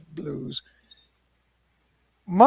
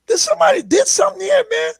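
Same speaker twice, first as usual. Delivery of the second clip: astonished shout. Retold or imitated. Walking into a huge cabin, shouting in amazement. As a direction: gasp it out in disbelief, fast.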